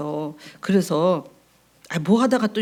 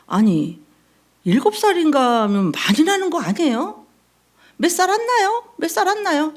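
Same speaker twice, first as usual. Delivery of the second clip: mock indignant high voice; 9 semitones higher, 4 dB louder than usual. Congregant mocks pastor's wife's age.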